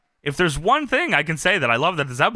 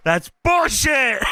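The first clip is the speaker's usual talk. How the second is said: slow, higher mock shout; mock outrage; 7 semitones higher, much slower than usual. Imitating a wrestler arguing backstage, slow mock-angry outburst. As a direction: draw it out in hammed-up indignation.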